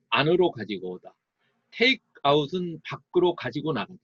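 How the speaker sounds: usual speaking voice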